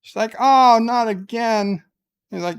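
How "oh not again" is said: higher and louder groan of annoyance; hammed-up mother exasperated at the captain; exasperated cry. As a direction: throw up your hands vocally. Mother exasperated about her son.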